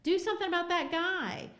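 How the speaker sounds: mock a man pushing blame onto her; whiny demand. Minister dumping responsibility on Hester, high mocking whine. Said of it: "is what he's basically saying".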